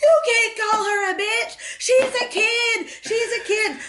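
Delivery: put-on high, teary little boy voice; whiny kid. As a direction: squeal it like an upset little boy. Boy at pool protesting.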